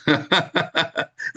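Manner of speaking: short burst of laughter. Real, not acted. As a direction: laugh at your own joke, high. Preacher laughs at own remark. (tertawa lepas)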